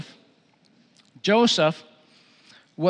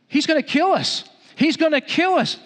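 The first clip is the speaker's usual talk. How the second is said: high, louder cry of alarm; acted panic; 10 semitones higher, 5 dB louder than usual. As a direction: play the terrified brothers, pitch jumping up. Brothers panicking on recognizing Joseph.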